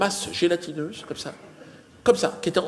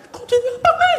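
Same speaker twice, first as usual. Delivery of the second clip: squeaky, louder voice, laughing through it; high mock voice; 7 dB louder, faster than usual. Comedian mimicking the oblivious mother-in-law, squeaky and high. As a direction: mimic her chattering on, very high.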